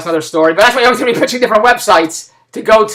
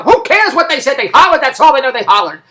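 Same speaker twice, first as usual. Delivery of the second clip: higher, louder impatient comeback; exasperated retort; 7 semitones higher, 5 dB louder than usual. Retold or imitated. Friend refusing to explain what was hollered, exasperated. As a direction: brush off the question, fed up. Friend snaps back in retold argument.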